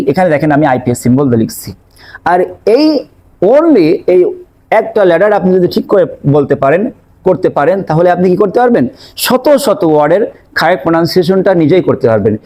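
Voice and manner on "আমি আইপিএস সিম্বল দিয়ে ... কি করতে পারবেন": usual speaking voice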